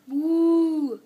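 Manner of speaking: loud mooing cow; a lowing moo. Acted. Child imitating a cow.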